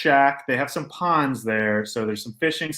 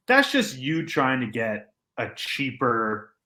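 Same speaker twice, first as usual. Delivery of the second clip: normal talking voice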